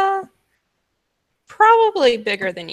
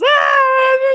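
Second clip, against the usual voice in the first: high, loud animal screech; monkey shriek; 6 dB louder than usual. Acted. The monkey at the wagon, a high loud screech. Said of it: "making this".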